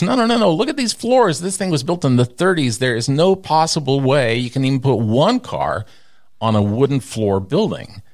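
high, insistent architect voice; mock architect. Architect telling owner floors are too weak, high insistent. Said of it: "And he goes".

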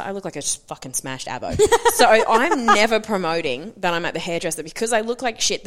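normal talking voice

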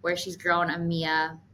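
usual speaking voice

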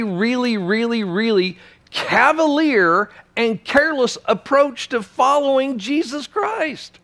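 very high, pressing sermon voice; fiery preaching. Real, not acted. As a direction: push the warning hard. Preaching climax, raised voice.

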